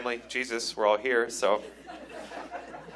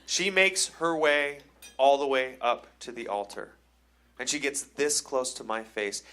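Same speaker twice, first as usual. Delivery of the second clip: usual speaking voice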